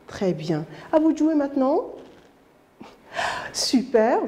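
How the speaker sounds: usual speaking voice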